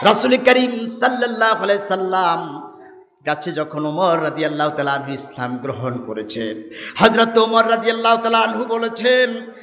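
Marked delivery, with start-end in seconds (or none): none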